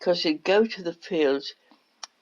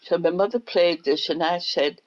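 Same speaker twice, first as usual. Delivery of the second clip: normal talking voice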